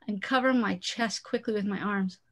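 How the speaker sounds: usual speaking voice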